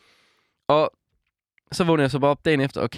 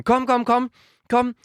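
higher, urgent mock shout; retold shouting; 11 semitones higher than usual. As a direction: call the others urgently. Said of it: "råber til de andre sådan". (genfortalt råb, højere og presserende)

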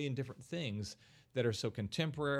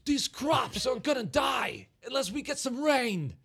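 imitating Christopher Walken's bad Kansas farmer accent, high, loud and slow; hammed-up Kansas drawl as Walken; overacted Walken doing a Kansas accent; 13 semitones higher, 7 dB louder, slower than usual. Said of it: "hear him trying to do this Kansas accent".

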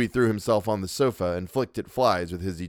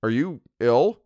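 concerned Louis; 5 semitones higher, 4 dB louder than usual. Acted. Louis worried.

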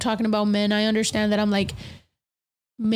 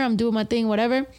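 ordinary talk, nothing marked

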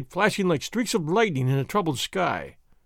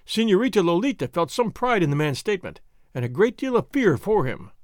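ordinary delivery

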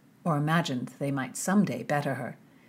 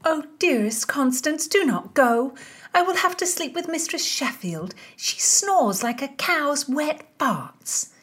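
high, mock-pleading lady; pleading friend; 9 semitones higher, 4 dB louder than usual. A narrator's reading. Mary begs Constance not to leave, high pleading.

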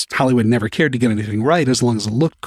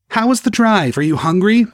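much higher, louder motherly fuss; excited mom; 10 semitones higher, 5 dB louder than usual. Acted. Mother hugs her son and fusses over him, excited. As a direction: gush over your returning son.

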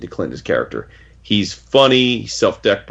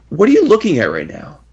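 higher, animated puzzled question; mock bafflement; 8 semitones higher, 4 dB louder than usual. Retelling Clint questioning Daredevil, higher baffled voice. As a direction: play the baffled hero with raised pitch.

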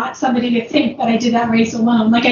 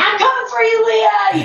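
mock scream; 10 semitones higher than usual. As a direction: imitate screaming after a runner ahead. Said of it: "I'm, like, screaming at her".

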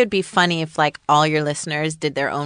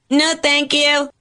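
higher, louder, playfully firm no; emphatic refusal; 9 semitones higher, 5 dB louder, slower than usual. Acted. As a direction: bat it away with a bright loud no.

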